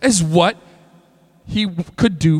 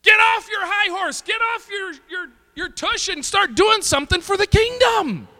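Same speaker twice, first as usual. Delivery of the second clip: much higher, forceful rebuke; preaching shout; 12 semitones higher than usual. Real, not acted. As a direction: shout it at the church.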